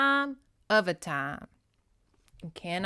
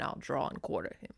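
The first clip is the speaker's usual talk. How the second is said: menacing threat; 12 semitones lower, 7 dB quieter than usual. Acted. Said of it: "as best as I can act them".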